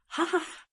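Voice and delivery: high booming belly laugh; hearty laugh. Acted. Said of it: "as he laughed".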